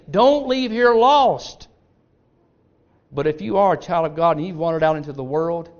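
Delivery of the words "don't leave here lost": "Don't leave here lost" slow, much higher and louder; loud plea. Acted. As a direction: cry out "Don't leave here lost" slowly, with full force. Repeated plea, now loud.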